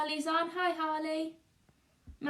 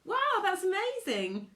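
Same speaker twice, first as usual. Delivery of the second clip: thrilled; 9 semitones higher, 3 dB louder than usual. Real, not acted. Thrilled reaction.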